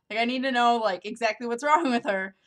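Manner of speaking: rising, loud, frantic need to know; panicked daughter demanding answers; agitated and loud. Real, not acted. Panicked about mom's condition.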